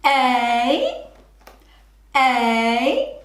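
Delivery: A said as a short separate sound, then again. Shown as a demonstration. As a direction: say A, pause, say a. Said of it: "Say train".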